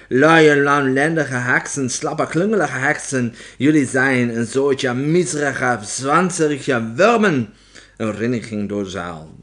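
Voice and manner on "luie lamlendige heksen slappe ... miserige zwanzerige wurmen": loud, slow, contemptuous tirade; scolding witch. A narrator's reading. Opperheks berating her lazy witches, yelling. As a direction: draw out each insult at full voice.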